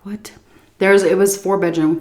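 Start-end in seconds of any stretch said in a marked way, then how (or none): 0.0-0.3 whisper. Retold or imitated. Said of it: "and I'm like".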